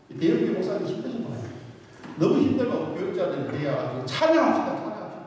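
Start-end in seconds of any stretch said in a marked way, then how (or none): 4.1-5.1 high rallying call that fades; mock worship leader. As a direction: cheer the crowd to sing, then trail off. Imitating worship leader calling out. (찬양 인도자가 회중에게 찬양하자고 높게 외치듯)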